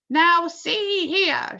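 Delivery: slow, high and loud; police chief hailing the roof; shout. A narrator's reading. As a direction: call up through cupped hands. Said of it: "shouted the chief of police".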